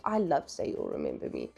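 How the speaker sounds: ordinary talk, nothing marked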